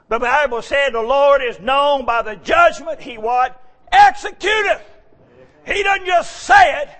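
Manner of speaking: high, loud fired-up sermon cadence; preaching at full force. Acted. Preacher calling to the congregation, loud and fervent.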